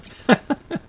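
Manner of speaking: real high emotional laugh; a short breaking laugh through tears; high teary laugh. Real, not acted. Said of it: "Having a moment here".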